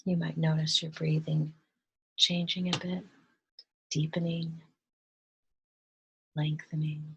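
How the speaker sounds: soft, hushed meditation voice with long pauses; guided meditation. Real, not acted. Guide leading listeners to relax and breathe, hushed meditation voice.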